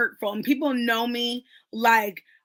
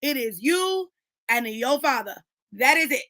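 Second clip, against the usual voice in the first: preaching; 3 semitones higher, 4 dB louder than usual. Real, not acted. Preaching emphatically.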